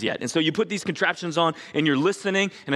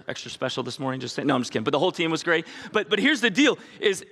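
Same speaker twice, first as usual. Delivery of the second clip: ordinary talk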